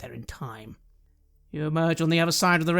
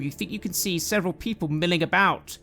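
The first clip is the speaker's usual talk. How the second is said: ordinary talk, nothing marked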